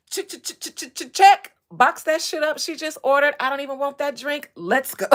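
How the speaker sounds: loud, high, urgent impression; hammed-up excited hollering; mock eagerness. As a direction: act out someone frantic to leave. Acting out eager man rushing to leave.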